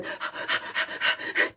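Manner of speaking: sharp unvoiced gasp of fright; mock fright gasp; startled gasp. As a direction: gasp like you've seen a tiger. Startled by tiger.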